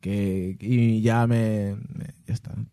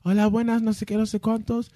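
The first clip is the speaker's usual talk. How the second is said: alarm operator voice; 10 semitones higher than usual. Retold or imitated. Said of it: "Me habla la de la alarma".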